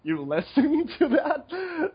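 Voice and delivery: high, drawn-out incredulity with a laugh; mock disbelief. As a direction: act amazed that anyone listens. Retold disbelief at a listener.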